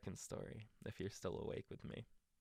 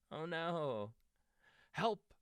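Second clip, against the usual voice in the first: high, hammed-up panicked cry; crying for help; 9 semitones higher, 6 dB louder, much slower than usual. Toad watching his list blow away, mock panic. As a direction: squeal it like a frightened toad. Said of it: "cried Toad".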